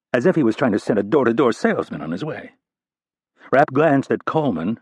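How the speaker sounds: usual speaking voice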